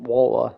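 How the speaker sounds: slow "Walter", T left out; "Walter" drawn out as "Wol-uh"; Walter without the T. Shown as a demonstration. Streamer admitting they can't say the name "Walter", T dropped. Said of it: "the T in it".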